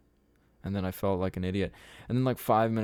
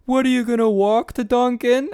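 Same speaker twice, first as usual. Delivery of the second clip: loud, high mocking yell through laughter; shouted taunt; 14 semitones higher, 10 dB louder than usual. Driver shouting out the window at the marching girl, laughing and loud. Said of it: "this guy shouts out the window".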